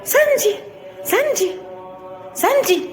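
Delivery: put-on high pleading calls to the girl; anxious calling.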